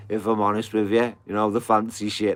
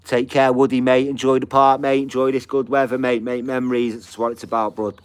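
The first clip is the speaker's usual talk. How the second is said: loud, higher call across the park; friendly farewell called from a distance; 5 semitones higher, 8 dB louder than usual. Real, not acted. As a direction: shout a cheery goodbye to a mate walking off. Calling goodbye to friend at park.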